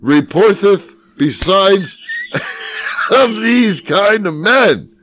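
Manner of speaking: high, loud, slow olde-English proclamation; pompous archaic declaiming, high and slow; mock archaic reading. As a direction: ham up the old text like a town crier. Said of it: "reading old text mockingly".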